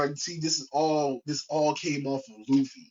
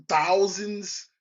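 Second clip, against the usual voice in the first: ordinary delivery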